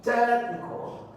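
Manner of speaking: technical slow, TECH high and held; slow teaching TECH-nical; stress on first syllable. Shown as a demonstration. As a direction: stretch TECH, then drop on -nical in technical. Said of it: "is the first syllable".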